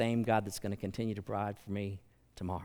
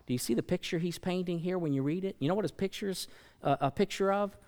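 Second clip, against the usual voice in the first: ordinary delivery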